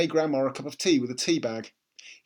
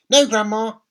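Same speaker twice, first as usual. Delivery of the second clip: put-on higher, louder young boy; dutiful small boy answering; little boy's voice; 9 semitones higher, 11 dB louder than usual. A narrator's reading. George answers grandma.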